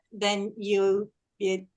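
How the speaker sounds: usual speaking voice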